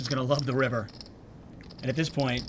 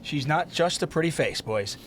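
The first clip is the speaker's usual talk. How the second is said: normal talking voice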